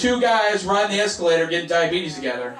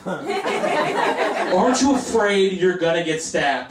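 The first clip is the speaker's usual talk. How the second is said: slow, drawn-out higher-pitched question; exaggerated mock worry; 4 semitones higher, much slower than usual. Comedian mimics fearful friends and family, hamming it up slowly. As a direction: stretch the worried question out mockingly.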